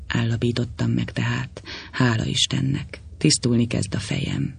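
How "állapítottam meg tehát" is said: plain reading voice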